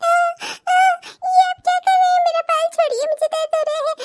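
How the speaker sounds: high, very slow pleading in pain; pained plea. Acted. Wife begging her husband to let go of her hair, pained. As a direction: cry out as he pulls your hair.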